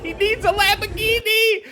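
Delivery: high-pitched mocking cry; mimicking the screamer. Reactor echoing the attacker's Lamborghini cry, high mock yell. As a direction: echo it in a silly high yell.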